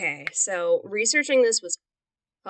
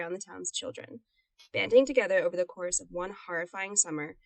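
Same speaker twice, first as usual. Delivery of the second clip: reading aloud; 3 semitones lower, 5 dB quieter than usual. Shown as a demonstration. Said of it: "That was great".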